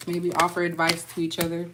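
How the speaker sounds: ordinary delivery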